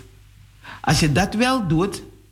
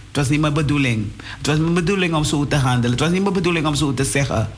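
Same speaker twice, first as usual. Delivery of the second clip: fast, tumbling confession to God; hurried prayer of apology; rushed prayer; much faster than usual. Retold or imitated. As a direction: rattle off the plea in a hurry. Preacher voicing his own prayer.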